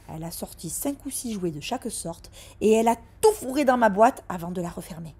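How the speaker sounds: ordinary delivery, nothing marked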